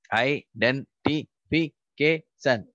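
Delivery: Identification drawn out syllable by syllable; teacher breaking Identification into syllables; slow syllabified. Shown as a demonstration. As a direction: say Identification slowly, beat by beat. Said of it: "we are going to syllabify the following words".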